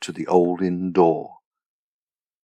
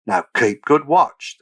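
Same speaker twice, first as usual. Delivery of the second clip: higher, slow, taunting order; mock command; 6 semitones higher, 4 dB louder than usual. Soldiers jeering at the bound daughter, mock command. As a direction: call it out slowly as a jeer.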